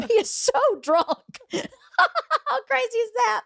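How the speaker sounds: high, squealing laugh through the words; words breaking up into laughter; laughing in disbelief. Real, not acted. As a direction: crack up while telling it. Laughing at own drunken-driver memory.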